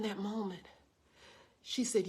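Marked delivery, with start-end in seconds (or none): none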